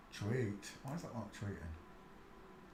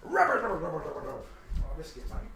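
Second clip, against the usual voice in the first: talking to a dog; 4 semitones higher, 8 dB quieter, much faster than usual. Real, not acted. Fussing over his dog Sheila.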